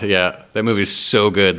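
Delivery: normal talking voice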